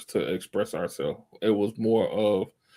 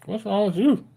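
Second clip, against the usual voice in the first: incredulous stare; 10 semitones higher than usual. Retold or imitated. People staring at the patient.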